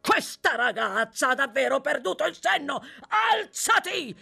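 loud, high outburst; rage, then bellow the order; exasperated yell climbing to a command; furious shouting. Acted. Sykes rages, seizing Nancy violently.

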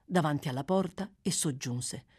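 usual speaking voice

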